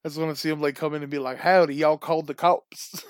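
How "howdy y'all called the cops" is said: hammed-up bad Southern accent, louder and slow; cheesy cowboy Southern accent, drawn out. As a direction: play a fake Texas cop with a terrible Southern accent.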